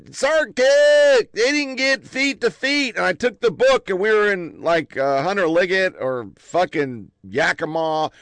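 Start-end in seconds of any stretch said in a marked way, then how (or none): 0.1-3.0 high, slow, whiny mock lieutenant; imitating the lieutenant. Sergeant retelling the young lieutenant's complaint, in a high, whiny mock voice. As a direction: lightly mimic a fussy young officer. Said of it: "and he goes".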